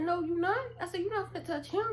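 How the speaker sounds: high, loud protest; defiant protest. Retold or imitated. Defending her friend from her brother, high and loud. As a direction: stand up to him.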